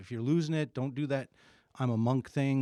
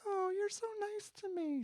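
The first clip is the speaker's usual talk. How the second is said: much higher, softer sugary wife voice; wife's sweet voice; 7 dB quieter than usual. Retold or imitated. Husband mimics his wife's high sweet voice when happy. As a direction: squeak it out like a fond wife. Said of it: "when I like her and she likes me".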